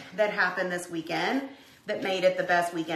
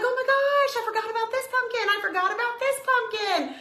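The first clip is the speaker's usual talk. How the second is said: much higher, gushing over a forgotten pumpkin; excited squeal; 13 semitones higher than usual. Retold or imitated. Host retells finding her pumpkin, gushing in a high, excited voice. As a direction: squeal it like greeting an old friend. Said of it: "I'm like".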